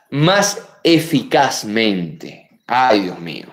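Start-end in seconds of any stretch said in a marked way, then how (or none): none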